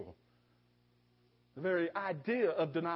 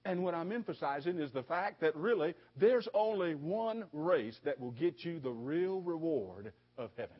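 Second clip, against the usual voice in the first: ordinary talk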